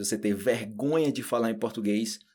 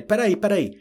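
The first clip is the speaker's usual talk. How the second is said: urgent interjection; 9 semitones higher, 6 dB louder than usual. Retold or imitated. Student asking him to wait.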